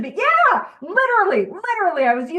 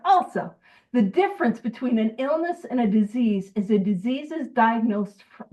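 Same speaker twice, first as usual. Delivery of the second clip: usual speaking voice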